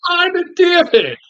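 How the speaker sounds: high, drawn-out frustrated curse; exasperated cursing. Retold or imitated. Fed up with bloodless violence.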